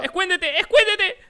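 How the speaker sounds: being chased. Real, not acted. Urging to hide in game.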